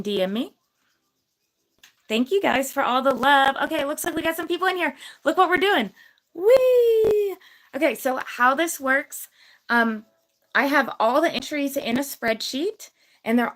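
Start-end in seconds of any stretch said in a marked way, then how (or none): 6.3-7.4 high loud whee; excited squeal. Real, not acted. Streamer squeals while spinning the wheel for viewers, high and loud. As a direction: squeal with glee as the wheel spins.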